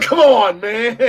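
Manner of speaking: high-pitched comic pleading; retold exasperated protest. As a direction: protest loudly, then laugh it off.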